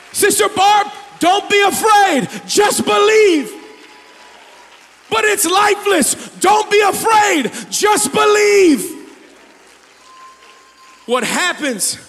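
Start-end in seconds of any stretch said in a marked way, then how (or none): 0.1-9.1 loud, high, slow preacher shout; full-force preaching. Acted. Preacher shouting the promise to Sister Barb. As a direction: cry it out to the congregation.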